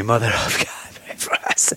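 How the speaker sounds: gasped, whispered prayer with no breath left; winded whispered plea; breathless whisper. Retold or imitated. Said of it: "I'm like".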